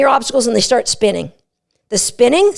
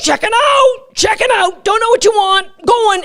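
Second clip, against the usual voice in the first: zooming dog shouting; 9 semitones higher than usual. Retold or imitated. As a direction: yell it like a dog doing laps. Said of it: "They're just like".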